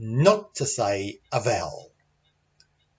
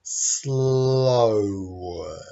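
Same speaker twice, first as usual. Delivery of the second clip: SLOWER stretched right out. Shown as a demonstration. Teacher demonstrating stressed syllables to learners, SLOWER drawn out. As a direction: drag SLOWER out long and slow. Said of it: "and a little slower".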